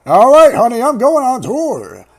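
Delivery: loud, high, hammed-up dad farewell; mocking, over-projected husband voice; departing dad. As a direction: holler a cheery goodbye like a cartoon dad. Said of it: "he's like, he's like".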